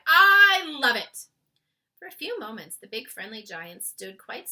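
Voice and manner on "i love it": very loud, high, slow ecstatic cry; delighted cry. A narrator's reading. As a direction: cry out with pleasure. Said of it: "he cried".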